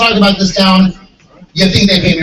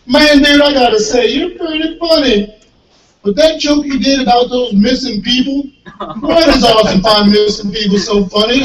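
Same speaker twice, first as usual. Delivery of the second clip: country bumpkin; 5 semitones higher than usual. Retold or imitated. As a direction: play a puzzled country bumpkin who missed the joke. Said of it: "this country bumpkin came up to me".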